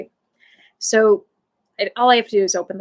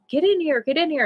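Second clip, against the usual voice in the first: higher, urgent call to the dog; 6 semitones higher, faster than usual. Retold or imitated. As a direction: call her in from the yard, pitched up. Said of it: "I'm like, you know".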